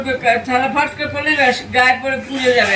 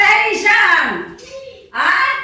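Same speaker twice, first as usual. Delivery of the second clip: calling out; 9 semitones higher, much slower than usual. Real, not acted. Calling child to eat.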